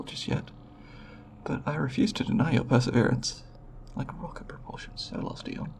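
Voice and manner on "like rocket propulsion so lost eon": quick, very soft undertone; hushed, hurried remark to oneself; muttered aside. Acted. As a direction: mutter it under your breath. Muttered aside on primitive space travel.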